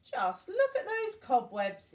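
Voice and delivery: lady exclaims at the dusty castle; exclaiming. A narrator's reading. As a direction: cry it out in shocked delight. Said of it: "exclaimed Missus Honey".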